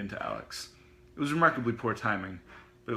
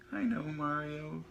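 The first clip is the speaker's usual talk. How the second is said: higher, slow dreamy voice; 6 semitones higher, slower than usual. Acted. Otto gazing at the gas man, dreamy. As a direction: say it in a happy daze. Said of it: "said Otto dreamily".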